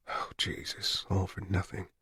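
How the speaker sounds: hushed, breathless whisper of dread; barely voiced, to himself; despairing whisper. Man fears the payphone ate his coins, whispered.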